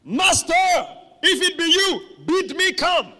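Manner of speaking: loud, high declamation; outspoken Peter. Acted. Preacher acting outspoken Peter addressing the Master, bold declaiming. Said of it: "very, very outspoken".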